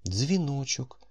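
gentle woman's voice. A narrator's reading. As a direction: lift into a kind mother's tone. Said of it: "лагідним жіночим голосом".

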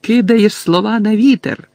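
loud, high taunt; Harry shouting. A narrator's reading. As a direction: yell it out defiantly. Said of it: "крикнув Гаррі". (гучний зухвалий вигук)

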